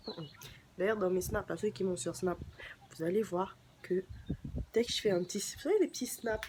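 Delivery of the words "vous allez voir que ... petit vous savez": ordinary talk, nothing marked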